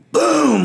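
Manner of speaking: high, drawn-out "boom" falling away; imitated explosive boom. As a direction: sound out the bar exploding upward. Imitates the explosive press off chest.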